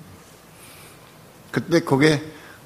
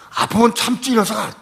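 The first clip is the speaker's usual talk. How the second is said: put-on much higher, louder shout at the boy; angry yell; 12 semitones higher, 6 dB louder than usual. Father yelling at his toothache-stricken son, angry shout. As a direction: snap at the crying son to bear it. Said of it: "그 소리를 버럭 질렀어요".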